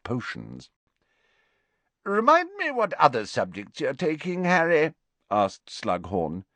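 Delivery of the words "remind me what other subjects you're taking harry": jovial professor. A narrator's reading. Slughorn voiced in story.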